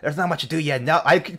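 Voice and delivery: ordinary talk, nothing marked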